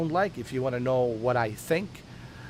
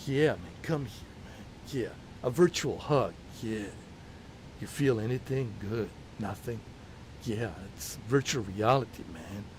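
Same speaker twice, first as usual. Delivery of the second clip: lightly lowered laid-back Keanu voice; Keanu impression; 5 semitones lower, 6 dB quieter than usual. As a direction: mellow dude-speak, a bit lower. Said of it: "And I was like".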